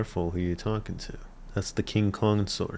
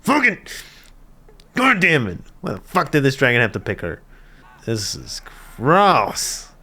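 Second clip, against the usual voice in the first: high, loud mock-furious outburst; 5 semitones higher, 6 dB louder than usual. Viewer voicing the furious character for laughs, mock fury. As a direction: act out an exasperated, furious rant. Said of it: "He's furious. He's like".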